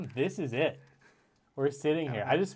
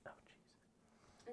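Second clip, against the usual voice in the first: whispering; 28 dB quieter than usual. Real, not acted. Off-mic whisper.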